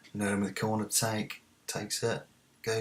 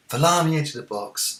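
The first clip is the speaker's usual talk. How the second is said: raised, urgent football commentary; excited match call; 8 semitones higher, 9 dB louder than usual. Real, not acted. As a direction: burst of loud, higher commentary. Live match call, attack begins.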